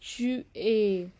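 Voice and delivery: चुए drawn out very slowly, like French "tu es"; very slow French demo. Shown as a demonstration. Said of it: "ये है verb एत्र की कॉन्जुगेजियो".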